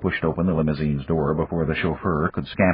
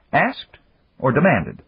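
Asked pitched much higher, rising sharply; mocking question; 7 semitones higher, slower than usual. A narrator's reading. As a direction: needle him with a high rising Asked. Dan challenges minister.

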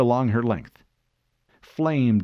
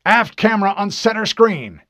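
loud, high command; firm, raised officer's voice; captain's order; 10 semitones higher, 7 dB louder than usual. A narrator's reading. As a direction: call the order across the bridge. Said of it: "Captain Keyes ordered".

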